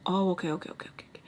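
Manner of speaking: repeated okays fading into a whisper; awkward retreat. Retold or imitated. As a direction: back off, trailing away to almost nothing. Retold awkward retreat, fading away.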